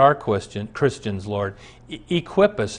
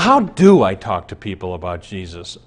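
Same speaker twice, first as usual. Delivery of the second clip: ordinary talk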